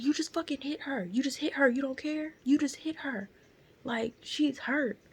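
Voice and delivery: high, insistent bystander voice; imitated scolding. Bystander telling off the driver for hitting her, imitated indignation.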